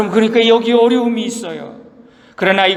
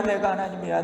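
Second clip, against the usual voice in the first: 내가 하나님이야 dropped to a soft murmur; quiet repeat; 8 dB quieter than usual. Acted. God repeating to Job, softer and quieter. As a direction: say it again softly, almost to yourself. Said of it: "하나님 말씀하시기를".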